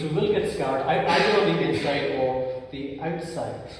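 usual speaking voice

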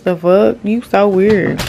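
ordinary talk

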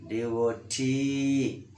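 "D O T" slowly, holding the T; short D O, then a long stressed T in "D O T"; stress on last syllable. Shown as a demonstration. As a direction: lengthen the final T of "D O T". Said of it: "That is the stress word".

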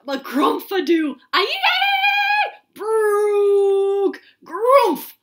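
loud, high nonsense gibberish; silly sounds. Pretend. Reader forced to make the book's silly sounds, loud. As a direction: rattle off the nonsense wildly. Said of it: "make silly sounds like".

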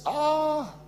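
high, drawn-out "ah"; long high wail; imitated worship cry. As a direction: mimic an overcome worshipper's cry. Mimics worshipper under anointing.

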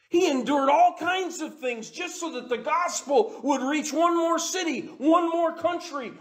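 high, loud, ringing sermon climax; fervent preaching. Acted. Preacher drives home Paul's sacrifice, fervent and loud. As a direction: preach it out with rising fire.

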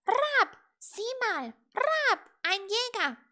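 very loud, high raven squawk; croaking raven. A narrator's reading. Raven to little witch on spotting a hunter, croaking. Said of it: "krächzte nach einer Weile der Rabe".

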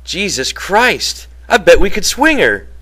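hushed amazement at the dream; reverent. A narrator's reading. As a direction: breathe it out in wonder. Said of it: "George said reverently".

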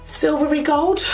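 ordinary talk

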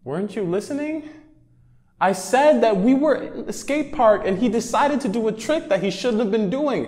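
high, impatient explaining voice; exasperated friend. Acted. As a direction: skit friend annoyed, high. Skit, explaining to helper.

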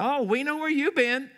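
mocking church folks. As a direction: tease like someone in on the joke, with a laugh in between.

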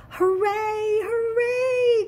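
high, loud sung-out cheer; cheering hooray. Acted. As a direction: shout it for joy. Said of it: "cried the little clown".

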